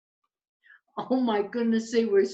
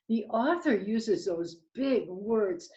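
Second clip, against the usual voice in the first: usual speaking voice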